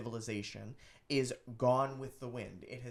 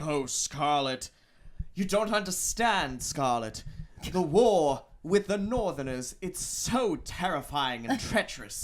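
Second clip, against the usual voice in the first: loud, plummy mock British accent; hammed-up British leading man, no attempt at Southern; Ashley Wilkes; 3 semitones higher, 6 dB louder than usual. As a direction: overwrought film-actor British accent. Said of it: "doesn't even attempt a Southern accent".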